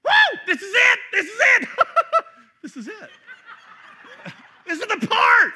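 high, loud joyful yelling with laughter; Adam shouting for joy at first seeing Eve; ecstatic shout. Retold or imitated. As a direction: cheer it like finding treasure. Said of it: "it reads like this".

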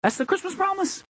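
high-pitched proclamation; full-force preaching. Real, not acted. Pastor proclaiming the Christmas promise, full-force preaching. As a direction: lift the whole line high and declare it.

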